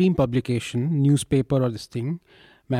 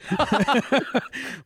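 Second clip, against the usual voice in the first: high laugh that breaks out and falls away; burst of laughter; 8 semitones higher than usual. Real, not acted. Co-host cracks up at the joke about seeing the ghoda doctor when sick, high laugh.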